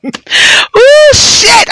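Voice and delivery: high, sudden cry of shock; squealed ooh shit; startled yelp. Real, not acted. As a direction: jump at something unexpected.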